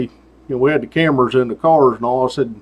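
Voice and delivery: ordinary delivery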